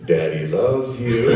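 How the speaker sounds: low, loud, very slow delivery; drawn-out, deep mock reassurance; imitated mall guard. Guard to stranger in the stall, loud slow mock comfort.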